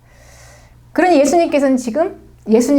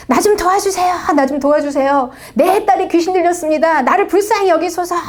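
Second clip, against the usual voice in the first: imitated crying out; 5 semitones higher than usual. Woman begging Jesus for her possessed daughter, imitated crying out. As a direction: act out a desperate plea for help. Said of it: "계속 이렇게 소리 질렀겠죠".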